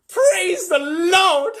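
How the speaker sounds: very high, loud, drawn-out cry; yelled. Acted. Grandpa Joe rejoicing, very high slow yell. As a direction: cry it to the heavens. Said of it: "yelled Grandpa Joe".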